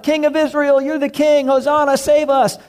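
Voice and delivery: high, ringing mock crowd cheer; crowd yelling. Preacher voices the Palm Sunday crowd hailing Jesus, a high cheering call. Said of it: "they're yelling out".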